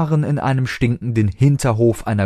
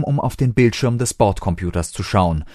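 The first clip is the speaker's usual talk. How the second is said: usual speaking voice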